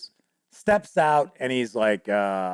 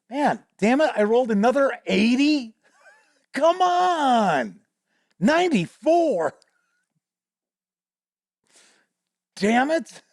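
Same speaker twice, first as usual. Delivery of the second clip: exasperated players; 9 semitones higher than usual. Retold or imitated. Players moaning over another bad dice roll, exasperated. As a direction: draw out the complaint on a high pitch.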